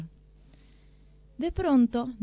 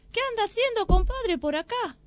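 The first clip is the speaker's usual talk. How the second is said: sly fox; 11 semitones higher than usual. Acted. Sly fox greets the other.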